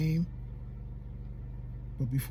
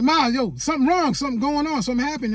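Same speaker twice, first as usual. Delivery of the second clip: higher, louder urgent retelling; urgent, raised-pitch cry for help; alarmed call; 7 semitones higher, 4 dB louder than usual. Retold or imitated. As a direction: call out to mom that something is wrong. Alarmed call to mom in retold emergency.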